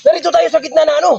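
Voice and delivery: agitated protest. Real, not acted. Upbraiding friends mid-quarrel.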